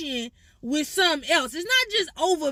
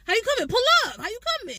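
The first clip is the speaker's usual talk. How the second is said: playful challenge, pitched up; spades table trash talk; 6 semitones higher, faster than usual. Shown as a demonstration. Playing spades, needling the other players, cocky taunt. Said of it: "Okay, I'm coming like this".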